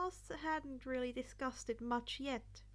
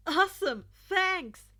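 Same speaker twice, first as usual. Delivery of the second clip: loud, higher, bursting with glee; excited shout; 6 semitones higher, 13 dB louder, slower than usual. Acted. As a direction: yell it out, delighted. Late student thrilled they waited.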